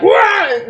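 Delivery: quick, high mock holler; imitated gamer yell. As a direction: mimic someone yelling at a video game. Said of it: "every now and then you be like".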